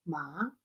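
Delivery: soft, short rising MA; second tone. Shown as a demonstration. Teacher running through the tones, soft rising "ma". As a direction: lift "ma" like a short question. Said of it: "el segundo".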